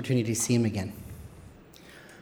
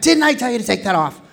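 loud, high angry father; retold angry shout at his son; mad dad; 9 semitones higher, 7 dB louder, faster than usual. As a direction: yell it like the furious dad. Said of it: "He gets so mad".

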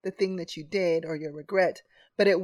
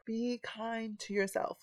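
softer, slower, tender repeat; 10 dB quieter, slower than usual. Shown as a demonstration. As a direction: say it again gently to yourself. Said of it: "Say it again".

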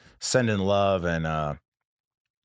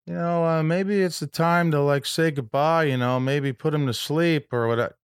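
imitating the friend's message, higher and slower; a pitched-up, sympathetic mock voicemail; Harlan's voicemail; 10 semitones higher, 4 dB louder than usual. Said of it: "he always leaves a message".

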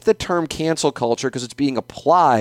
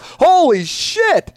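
much higher, drawn-out holy shit; disbelief; 12 semitones higher, much slower than usual. Real, not acted. Podcaster venting about a host fired, slow high disbelief. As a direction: stretch it out in real exasperation. Outraged at Harrison losing job.

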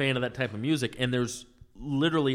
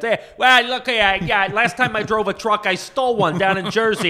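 loud, higher tough-guy prank voice; brash job seeker; 7 semitones higher, 6 dB louder than usual. Retold or imitated. Prank caller answering a want ad, brash. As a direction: belt it out as a pushy Jersey caller. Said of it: "he was calling to get a job".

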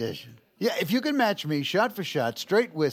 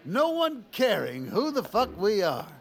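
put-on drunken shout; 7 semitones higher than usual. Drunk toasting Indiana.

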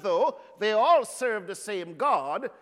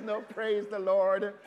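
ordinary delivery

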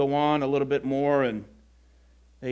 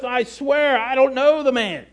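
swearing denial; 6 semitones higher, 5 dB louder than usual. Retold or imitated. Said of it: "he denied it with an oath".

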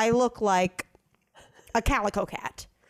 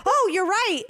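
higher-pitched imitation of sudden realisation; mock realisation; 7 semitones higher than usual. Technician told she missed a side, imitated in high surprise. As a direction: jump up in pitch on the dawning mistake.

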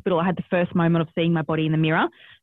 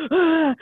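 straining groan; 9 semitones higher, 4 dB louder than usual. Retold or imitated. Straining in labour.

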